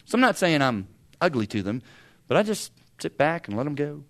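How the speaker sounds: ordinary delivery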